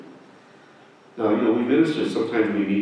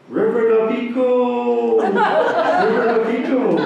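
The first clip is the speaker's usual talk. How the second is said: high, louder mock holler; 12 semitones higher, 4 dB louder than usual. Woman in a car calls to the minister, high mock holler. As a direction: call out the name across the street. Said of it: "she hollers out".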